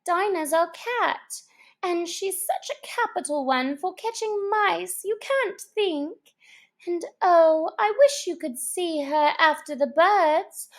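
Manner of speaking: high, gushing enthusiasm; eager. Acted. Alice telling the birds about her cat, eager. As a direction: gush about your beloved cat. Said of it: "Alice replied eagerly".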